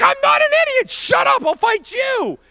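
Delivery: very high, loud acted tantrum; furious comeback. Young man threatening to fight his buddy, high furious yelling. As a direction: scream back at the insult.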